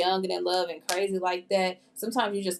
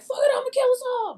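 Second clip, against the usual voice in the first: much higher, louder, careless mock voice; mock reckless; 6 dB louder than usual. Imagining the girl deciding to crash, mock reckless and high. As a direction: toss it off like she doesn't care. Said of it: "she intentionally was just like".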